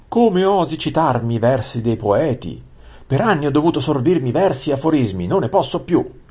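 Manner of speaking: high, loud, fast outburst; furious prince. A narrator's reading. Prince kicks the vizier and screams at him, furious. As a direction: explode with rage at the vizier.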